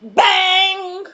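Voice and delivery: booming high bang; loud bang. Pretend. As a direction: slam it out loud. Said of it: "he would bang the door shut".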